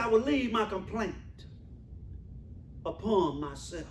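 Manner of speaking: soft, sinking repeat of Job's words; hushed echo of the complaint. Acted. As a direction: let it sink low and quiet. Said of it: "He said".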